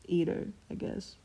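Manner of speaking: shrugging, offhand Eat her; bored tone. A narrator's reading. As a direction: toss it off like it hardly matters. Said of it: "in a bored tone".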